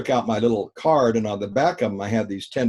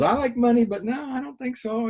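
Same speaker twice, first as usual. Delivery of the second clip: quoted woman; 13 semitones higher than usual. Retold or imitated. Voicing the woman's reply.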